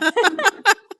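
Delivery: high, loud laughter. Real, not acted. Co-host finishing a grisly fairy tale, laughing high and loud.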